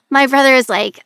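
usual speaking voice

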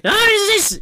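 high, loud indignant yell; screaming. Retold or imitated. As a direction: shriek at the rats. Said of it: "and just, like".

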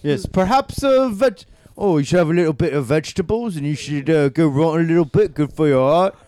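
put-on British voice, politely suggesting; British accent. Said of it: "with a British accent".

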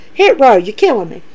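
ordinary delivery

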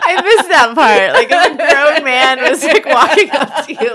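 words bubbling with laughter; laughing while talking. Real, not acted. Laughing at a funny story.

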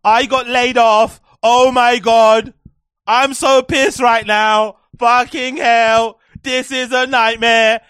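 mocking a dramatic tweet; mocking her tweet. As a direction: read her tweet as an overdramatic moan. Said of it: "She writing a tweet".

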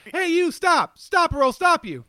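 put-on high, punchy old Speed Racer dub; overacted vintage dub hero shouting; vintage cartoon voice. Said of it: "like the classic, uh, Speed Racer".

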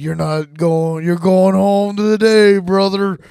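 slow, loud heartfelt soldier voice; rally a fallen brother; dramatic comrade. Acted. Role-play with a dying soldier.

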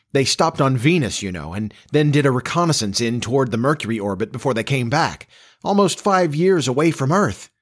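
put-on excited young man, lively pitch; eager son, sparkling. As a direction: tell your dad admiringly about your friend.

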